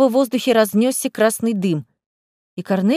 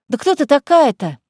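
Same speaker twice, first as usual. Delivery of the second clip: raised urgent shout; 4 semitones higher, 4 dB louder than usual. Acted. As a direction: call out after her. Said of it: "крикнула Лиза".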